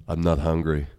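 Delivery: mopey sigh. Acted. Rambo sighs, lower and quieter.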